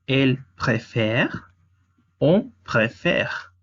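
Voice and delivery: "elle préfère, on préfère" drawn out slowly, stress on "fère"; slow French model. Shown as a demonstration. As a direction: model each form slowly for the class. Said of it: "Para il, elle, on es il préfère". ("elle préfère, on préfère" lento, acento en "fère")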